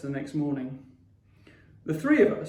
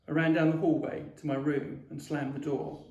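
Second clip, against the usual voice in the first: plain reading voice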